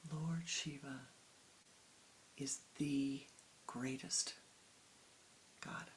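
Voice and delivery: soft, drawn-out, reverent imitation of the priest; a quiet try at the priest's devotion; imitating the priest's fervor. Priest tells Swamiji Shiva is greatest, soft reverent imitation. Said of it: "I didn't say it exactly the way he said it".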